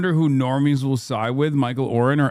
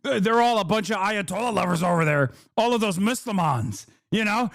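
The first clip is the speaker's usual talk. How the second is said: higher-pitched mock alarmist voice; mocking news host; 5 semitones higher than usual. Streamer imitating Fox host on Michigan voters, high mocking voice. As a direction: caricature a host smearing the locals. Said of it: "she's like".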